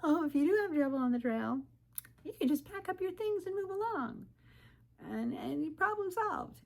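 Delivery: high, airy imitation of glib advice, slowing at the end; a hammed-up know-it-all hiker woman; mock breezy advice. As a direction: parrot the easy advice in a sing-song voice. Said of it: "advice that through hiker women will tell".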